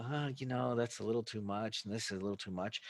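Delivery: soft, hesitant haggling with a doubtful huh; a quiet seller holding back, unsure; murmured reluctance over the price. Acted.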